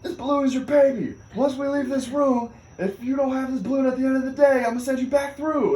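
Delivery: high, forceful drill-instructor yell; stern mock orders. Hazers warning engineers about their balloon, loud stern orders. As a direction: lay down the law, loud and high.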